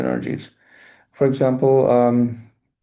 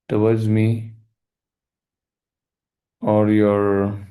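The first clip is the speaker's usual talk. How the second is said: guided meditation; 4 semitones lower than usual. Acted. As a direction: lead the listener gently into forgiveness. Leads a forgiveness meditation.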